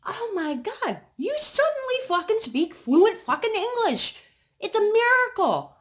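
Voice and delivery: very high, loud mocking disbelief; sarcastic outburst. Mocking the neighbor who suddenly speaks English, high loud sarcasm. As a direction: sneer it up high and loud like a fake miracle. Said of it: "And I'm like".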